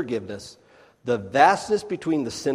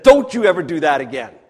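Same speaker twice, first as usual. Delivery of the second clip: louder, sharp warning to the servant; stern scolding; 3 semitones higher, 11 dB louder, faster than usual. Acted. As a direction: scold him like a master letting him off.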